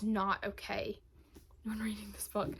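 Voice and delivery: breathy confessional whisper on the last words. Real, not acted. Booktuber admitting a book wrecked her, whispered. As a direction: let it drop to a hush, almost private. Admitting she was not okay.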